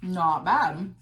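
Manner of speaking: talking with a mouthful; mouth full. Real, not acted. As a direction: speak through a mouthful of food. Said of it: "As I talk with my mouth full".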